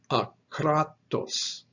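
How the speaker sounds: akratos with the middle syllable KRA stressed; slow a-KRA-tos, weight on the middle of akratos; stress on second syllable. Shown as a demonstration. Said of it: "with a stress on that second syllable".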